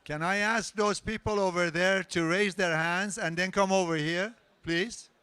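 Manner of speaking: calling out. Real, not acted. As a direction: call over the crowd to the chatting group. Host calls across the room again.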